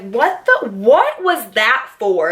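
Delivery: high, then slow, outraged what; stunned after being smacked; shocked protest. Retold or imitated. After the head smack, slow stunned protest to cousin. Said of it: "I literally look at her and I'm like".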